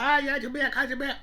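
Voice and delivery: high-pitched gushing over Jamaica; a light, hammed-up swoon; mock fawning. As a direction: imitate people raving about Jamaica. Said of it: "Everyone's like".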